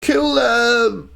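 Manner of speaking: high-pitched kobold scream; screaming battle cry. Acted. Lone kobold rallying the others to attack, screaming. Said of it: "he's the one s-, uh, screaming".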